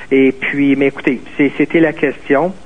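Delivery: usual speaking voice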